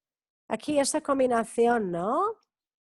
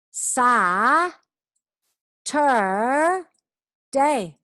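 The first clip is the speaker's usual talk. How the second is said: "Sa, tur, day" broken apart, louder; syllable by syllable; 4 semitones higher, 5 dB louder, much slower than usual. Shown as a demonstration. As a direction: split the word into its three beats. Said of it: "teacher splits word into syllables".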